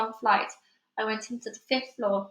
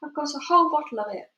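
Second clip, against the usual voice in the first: excited; 6 semitones higher, 4 dB louder than usual. Acted. Boy excited with bottle.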